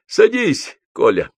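loud, high-pitched invitation; shouting. A narrator's reading. Аксаков shouts to Гоголь to sit with them, shouting. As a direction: shout at a friend to join. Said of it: "кричал Аксаков".

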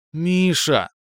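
high, drawn-out, tender call; drawn out affectionately. Acted. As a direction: stretch his name lovingly. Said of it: "ласково затянула Леночка".

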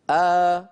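a long open آ held out; teaching the vowel آ; sound demo. Shown as a demonstration. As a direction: sustain the vowel آ clearly. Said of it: "واحفظوا هذه الأصوات الثلاثة".

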